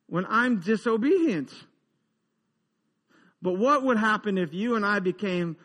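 ordinary delivery

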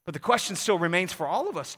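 ordinary talk

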